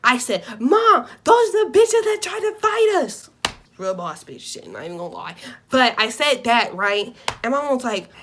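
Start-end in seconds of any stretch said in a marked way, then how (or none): 0.6-3.3 loud, high shouted warning; high yell. Retold or imitated. Daughter yelling to mom about the girls outside. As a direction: yell it to get mom's attention. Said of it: "the first thing I do is scream".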